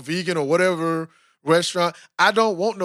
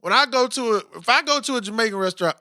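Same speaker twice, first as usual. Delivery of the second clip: ordinary talk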